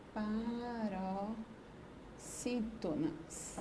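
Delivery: very slow, soft syllable-by-syllable dictation. Real, not acted. Teacher writes the answer for pupils, spelling it out slowly and softly. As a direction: sound out the word softly while writing it.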